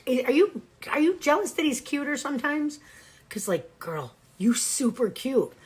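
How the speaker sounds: pet talk. Real, not acted. Scolding cats in pet talk.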